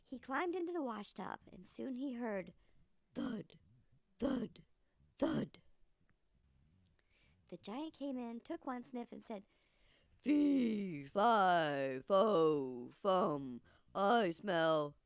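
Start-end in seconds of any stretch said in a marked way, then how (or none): 3.1-5.6 footsteps getting closer each time; approaching thuds. Acted. 10.2-15.0 deep, booming, slow giant. Acted. Giant sniffs and speaks.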